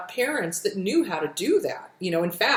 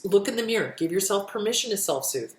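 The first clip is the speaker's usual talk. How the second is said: usual speaking voice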